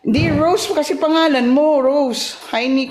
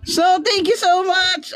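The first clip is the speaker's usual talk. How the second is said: practice voice; 7 semitones higher than usual. Pretend. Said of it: "practice ulit, practice lang".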